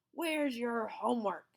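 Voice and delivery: loud, slow sharp demand; snapping. A narrator's reading. Strict teacher snapping at his old pupil. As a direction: snap the question. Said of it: "snapped the fifth grade teacher".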